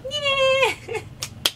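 high, choked laugh-cry; emotional laughing cry. Real, not acted. Mother hearing her baby cry, high laughing sob. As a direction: let the joy break into a sob-laugh. Baby's first cry moment.

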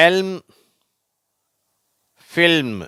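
எல்ம், ஃபில்ம் high-pitched with audible L before M; L pronounced. Shown as a demonstration. Teacher contrasting with silent L words, எல்ம், ஃபில்ம் with L sounded. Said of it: "இந்த வார்த்தையில உச்சரிக்கப்படுகிறது".

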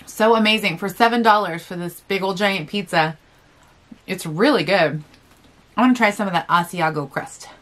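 words slurred by chewing; chewing while talking. Real, not acted. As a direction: mouth partly full of food. Seen 0: Bites pizza before; bites crust at 3.3, chewing through 4.8.

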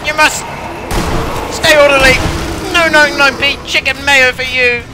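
high, loud yelling. Pretend. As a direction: bark orders at the crowd. Yelling at game customers.

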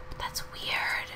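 hushed whisper aside. Real, not acted. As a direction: breathe it as a secret.

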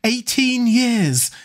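high, drawn-out jubilant cry; exultant, pitched well up; triumphant exclamation. A narrator's reading. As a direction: savour it slowly with rising triumph. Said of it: "laughed Dorian Gray with a touch of triumph".

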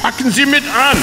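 hoch und laut: Packen Sie mit an; Aufforderung rufen. Acted. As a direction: energisch zum Mitanpacken rufen. Calls for help at the corpse. (Gerichtsmediziner ruft laut nach Hilfe beim Leichnam)

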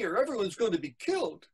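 fast, high-pitched warning; urgent boy in a story; alarmed boy. Acted. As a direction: rush it out in acted panic. Boy fears for the town.

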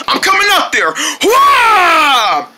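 high yell rising into a whoop; battle cry. Acted. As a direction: charge at the monster shouting. Charges at the monster.

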